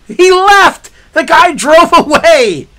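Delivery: high, loud disbelief; incredulous outburst. Retold or imitated. Caller seeing the police officer leave, incredulous. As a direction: exclaim it in outraged amazement.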